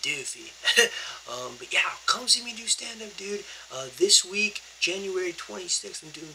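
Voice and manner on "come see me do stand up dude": high hushed whisper. Real, not acted. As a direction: whisper the invitation. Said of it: "I gotta be a little bit quiet".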